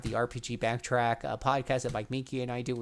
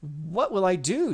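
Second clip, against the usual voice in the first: fearful; 6 semitones higher, 5 dB louder, slower than usual. A narrator's reading. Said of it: "she continues".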